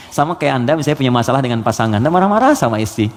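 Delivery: ordinary delivery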